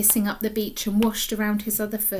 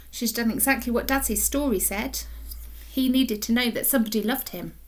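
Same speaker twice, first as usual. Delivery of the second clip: ordinary delivery, nothing marked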